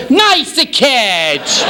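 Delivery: hammed-up cheer at the catch; mock cheer. Punchline in character voice.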